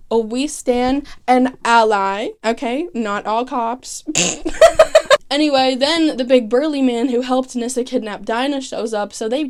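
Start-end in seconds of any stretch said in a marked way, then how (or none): 4.1-5.2 real laugh. Real, not acted. Laughs at own joke.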